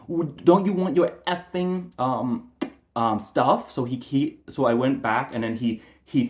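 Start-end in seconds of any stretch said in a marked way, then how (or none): 0.1-3.7 mocking taunt. Sergeant's mocking jab retold.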